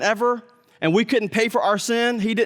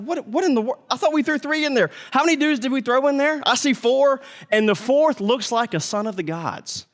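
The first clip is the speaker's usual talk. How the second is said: higher, stunned king voice; astonished king; 6 semitones higher than usual. Retold or imitated. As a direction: play the king gaping at the furnace. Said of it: "he's like".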